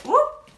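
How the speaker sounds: high, loud little whoop; playful whoop. Pretend. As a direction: pop out a bouncy whoop. Hopping into a squat.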